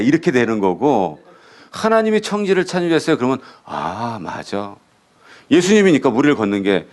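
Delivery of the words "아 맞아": soft, low murmur of agreement; acted quiet nod of agreement, said under the voice; easy, hushed "of course"; quiet acceptance. Acted listener accepting the claim.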